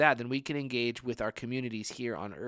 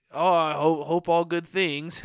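loud, higher, drawn-out mock reply; hammed-up hopeful quip, stretched slow; nervous joking; 5 semitones higher, 8 dB louder, slower than usual. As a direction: play an awkward joke to cover nerves. Nervous joke reply, acted out.

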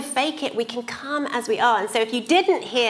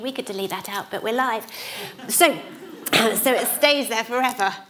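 ordinary talk

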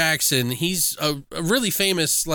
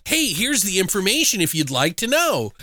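higher, mock-courteous offer; mock helpful announcement; 6 semitones higher than usual. Mocking how spoilers don't politely offer information, mock helpful. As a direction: put on a helpful informant's lilt. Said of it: "they're not, like, going".

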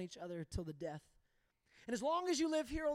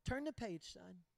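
softer, steady fatherly command; calm firm father; 6 semitones lower, 8 dB quieter than usual. Retold or imitated. Dad answering his upset son, a soft, steady firm voice. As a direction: say it quiet and sure, like dad.

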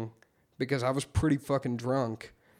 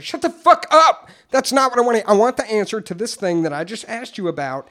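loud mock outburst, higher pitched; angry retort to the sponsor's advice, loud and higher; 7 semitones higher, 8 dB louder than usual. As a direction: snap back at the sponsor, hammed-up fury. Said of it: "Pissed me off".

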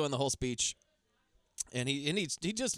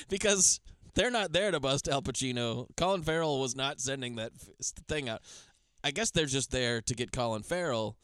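usual speaking voice

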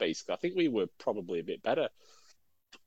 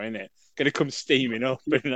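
ordinary talk

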